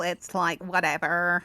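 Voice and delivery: put-on Valley Girl voice. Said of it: "you gotta get that vocal fry in".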